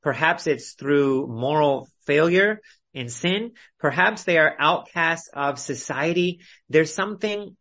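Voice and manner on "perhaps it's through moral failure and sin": normal talking voice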